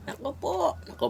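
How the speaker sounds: breathy laugh through nako po; laughing out a high nako po. Real, not acted. Laughing at the taste while eating. (tumatawang nako po)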